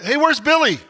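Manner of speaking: sudden confused call; lost camper wondering; surprised question. Retold or imitated. Blindfolded guy asks, surprised.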